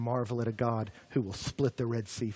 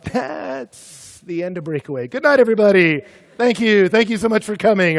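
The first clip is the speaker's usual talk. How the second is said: high, loud show-closing sign-off; mock farewell announcement; 6 semitones higher, 10 dB louder than usual. Pastor ending the ministry to an imagined crowd, mock farewell. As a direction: call it out like a doomed emcee.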